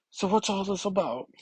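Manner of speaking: put-on a clumsy stab at a teen girl's voice; badly faked teen girl voice. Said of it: "Oof, that's a horrible voice".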